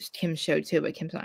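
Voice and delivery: ordinary delivery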